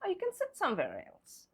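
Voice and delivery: hostess asking friend. Acted. Hostess asking a friend at her house, polite offer. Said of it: "Now I'm asking her".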